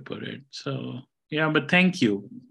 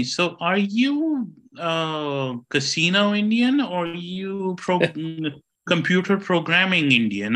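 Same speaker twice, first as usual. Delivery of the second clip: put-on sincere old-lady question, higher; sincere older lady; 6 semitones higher than usual. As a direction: ask it innocently, like a kind older lady on the phone. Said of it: "she was so honestly being sincere".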